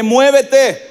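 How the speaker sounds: "Muévete" called out firmly to the neighbour; rallying call to move. Shown as a demonstration. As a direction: urge them on with a strong "Muévete". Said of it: "Dígale".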